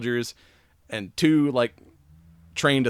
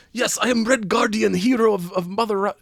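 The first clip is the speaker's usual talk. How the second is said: higher, hammed-up Russian accent as Alexei; a cheesy, proud Russian hero, pitched up; doing a deliberately bad Russian accent for Red Guardian; 8 semitones higher than usual. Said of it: "I can do bad Russian voice".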